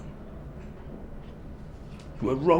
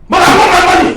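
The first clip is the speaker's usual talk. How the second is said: screaming demand, much higher and louder; furious shout; 17 dB louder, much faster than usual. Acted. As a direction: explode at her with full force. Son screams at mother.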